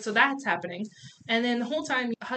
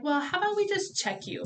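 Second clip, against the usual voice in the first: high, patronizing sing-song; pitched-up placating nurse voice; condescending nurse; 11 semitones higher than usual. Retold or imitated. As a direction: humor the dramatic patient. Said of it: "convinced that I'm just, like, dramatic".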